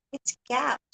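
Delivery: retold "cap"; quoting "cap". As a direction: quote the student's "cap". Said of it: "Sabi niya".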